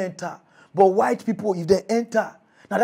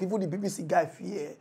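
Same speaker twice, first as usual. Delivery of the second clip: usual speaking voice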